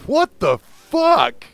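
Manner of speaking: high, slow incredulous outburst; stunned what the fuck. Retold or imitated. His reaction in line, high and drawn out. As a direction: drag it out in disbelief. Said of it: "I'm like".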